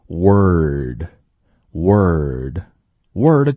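word drawn out slowly, rounded w into r-coloured vowel; 慢速示范word; slow word demo. Shown as a demonstration. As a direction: stretch word from 我 plus er. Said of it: "加上个儿化音就变成了word".

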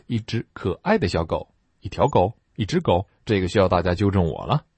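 normal talking voice

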